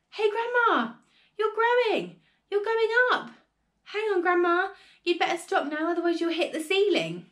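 high, loud warning shout; startled shout. A narrator's reading. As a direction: shout up urgently at Grandma. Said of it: "gave a jump and shouted".

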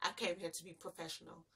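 "I came here to be professional" muttered under the breath in mockery; mocking her.

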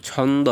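ছন্দ with an n, chhawn-do; wrong pronunciation. Shown as a demonstration. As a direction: put n before the d in ছন্দ. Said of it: "অনেকে ছন্দ বলে".

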